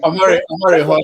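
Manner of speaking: loud call over the noise; calling out loudly. Real, not acted. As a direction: cut in to stop him. Host calling speaker to stop.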